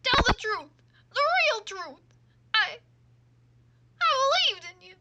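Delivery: high, loud, betrayed outburst; desperate demand. Acted. Terry demanding the truth from Dahlia, desperate shouting. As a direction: plead at full voice for honesty.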